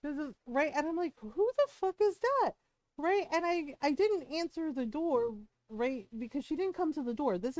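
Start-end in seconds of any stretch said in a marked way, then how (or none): 1.2-2.5 higher alarmed question; startled by the yelling outside; hearing screaming at night, startled. Retold or imitated.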